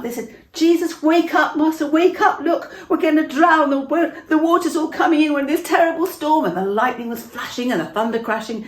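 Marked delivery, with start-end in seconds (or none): 0.5-6.5 high, loud, frantic cries for help; panicked shouting. Acted. Frightened disciples waking Jesus in the storm, high panicked shout. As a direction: shout as if the boat is sinking.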